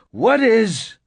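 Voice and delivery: high, loud demand; angry shout. A narrator's reading. Narrator demands an answer from the woman, angry shout. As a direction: shout the question in anger. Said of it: "I shouted, angry".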